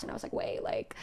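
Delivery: hushed scheming whisper; whispered idea. Retold or imitated. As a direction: breathe it out like a secret plan. Retold sudden idea, whispered.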